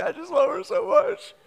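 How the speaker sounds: high, tearful, choked-up voice; father choked up, telling his wife he loves their daughter, high and tearful; retold crying. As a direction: relive the tears of love for your daughter. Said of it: "I start crying".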